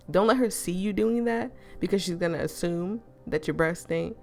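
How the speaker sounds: plain reading voice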